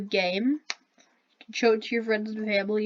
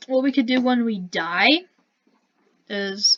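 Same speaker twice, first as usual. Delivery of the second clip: normal talking voice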